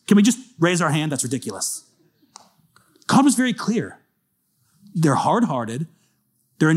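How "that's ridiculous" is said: "That's ridiculous" muttered much softer, lower; muttered repeat. Real, not acted. Preacher repeating it to himself, much softer. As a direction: drop "That's ridiculous" to a low aside.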